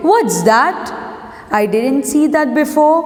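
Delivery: ordinary talk, nothing marked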